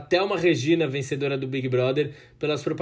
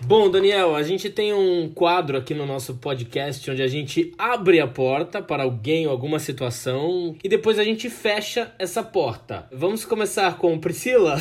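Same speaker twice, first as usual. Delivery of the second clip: upbeat host segment intro, louder; announcer voice; 2 semitones higher, 4 dB louder than usual. Acted.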